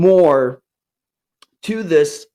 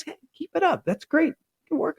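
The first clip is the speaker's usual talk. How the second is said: high, lifted cheering, a bit softer; upbeat praise; 12 semitones higher, 6 dB quieter, faster than usual. Shown as a demonstration. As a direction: encourage a student with a smile. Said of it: "but always upbeat, always really positive".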